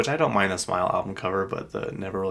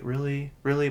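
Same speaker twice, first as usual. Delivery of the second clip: higher-pitched, unimpressed questioning; mock incredulity without raising the volume; retold disbelief; 6 semitones higher than usual. Retold weary disbelief at plans.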